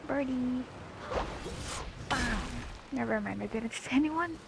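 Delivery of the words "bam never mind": ordinary talk, nothing marked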